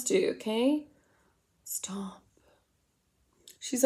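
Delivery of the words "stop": unvoiced hush; whisper. Real, not acted. Whispering stop to herself.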